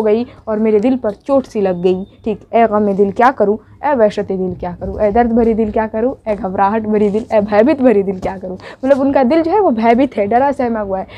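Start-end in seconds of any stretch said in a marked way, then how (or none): 2.5-8.6 poem recitation. Acted. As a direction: recite it like a lament to your own heart. Recites the refrain again.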